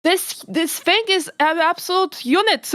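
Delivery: impressed exclamation at a giant toad; amazed exclaim. Real, not acted. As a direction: marvel at the huge toad. Reacts to the fat toad.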